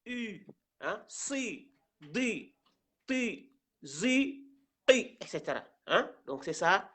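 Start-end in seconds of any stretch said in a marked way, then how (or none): 0.0-0.5 the vowel I shifted back towards "U"; I turned towards U. Shown as a demonstration. Teacher showing what the I becomes, darkened vowel. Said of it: "le I devient". 1.1-5.2 "si, di, ti, zi, i" with the I shifted towards U; darkened I sound. Shown as a demonstration. Teacher demonstrating the five letters, I darkened towards U. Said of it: "le I devient".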